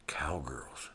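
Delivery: breathy hushed whisper of anticipation; hushed gleeful whisper; whispered thought. Retold or imitated. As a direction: whisper it like a secret hope. Imagining cowgirls, hushed excited thought.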